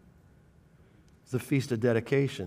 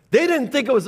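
mock shout; 11 semitones higher, 6 dB louder, faster than usual. Mocking the crowd again.

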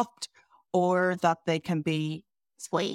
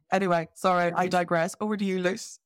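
ordinary delivery

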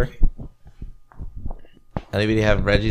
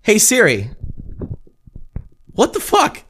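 calling out to phone; 9 semitones higher, slower than usual. Real, not acted. Phone not responding.

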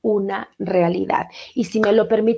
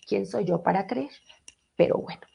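whispered aside; 8 dB quieter than usual. Real, not acted. Self-deprecating aside after cough.

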